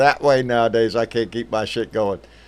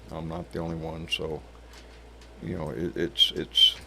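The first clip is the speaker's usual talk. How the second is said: softer, subdued and sorrowful; real grief, voice sinking low and soft; heavy-hearted, quiet; sad; 7 semitones lower, 10 dB quieter than usual. Real, not acted. Friend in a coma, breaks heart.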